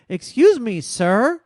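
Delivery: imitating a sneering man, called out loudly; loud mocking. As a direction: raise your voice on "Excuse me, sir" so the whole car hears. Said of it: "he said it very, very loudly".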